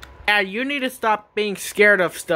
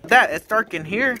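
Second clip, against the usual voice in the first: usual speaking voice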